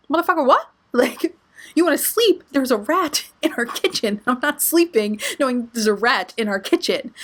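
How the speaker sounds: high-pitched, incredulous outburst with laughter breaking in; mock outrage. Woman telling tired partner about a rat, mock-outraged. Retelling her outraged reply to Meech.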